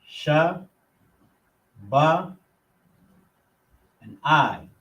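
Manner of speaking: Cha, ma, sha with a pause after each; syllable by syllable. Shown as a demonstration. Teacher breaking Chamash into syllables. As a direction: sound out each beat of Chamash.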